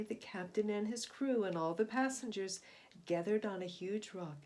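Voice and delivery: ordinary delivery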